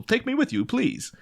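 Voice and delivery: put-on high, begging child voice; pleading kid. Child Dick begging Batman.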